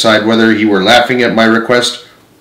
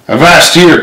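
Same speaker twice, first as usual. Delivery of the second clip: higher-pitched, mildly raised cry; understated narrated cry, pitched up; sharp cry; 7 semitones higher, 4 dB louder than usual. A narrator's reading. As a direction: call out a halt with some force. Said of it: "cried Silver".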